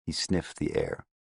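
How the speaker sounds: ordinary delivery, nothing marked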